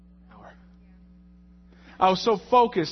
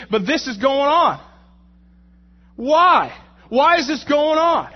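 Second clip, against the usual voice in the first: loud, slow, pleading voice raised high; anguished cry to God; 7 semitones higher, 8 dB louder than usual. Acted. Preacher voicing Paul's desperate plea, loud and anguished.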